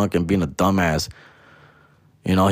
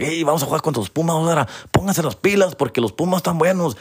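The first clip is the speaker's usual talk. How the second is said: fast, higher Spanish coach voice; rival coach; 7 semitones higher, faster than usual. Retold or imitated. Coach warning his team about los Pumas, fast and higher. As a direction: rattle it off like a fired-up coach. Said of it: "the coaches would be like".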